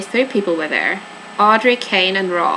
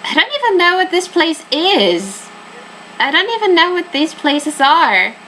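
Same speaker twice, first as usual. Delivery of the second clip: baffled; 8 semitones higher than usual. Real, not acted. Confused at the map.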